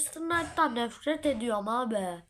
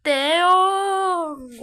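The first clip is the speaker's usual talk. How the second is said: loud, much higher cheer; excited shout; 7 semitones higher, 11 dB louder than usual. Real, not acted. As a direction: belt the name out. Names player, then shouts it.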